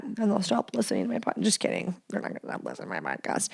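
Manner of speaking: quick unvoiced whisper; a hushed joking aside. Real, not acted. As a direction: rush it in a hush. Joke aside.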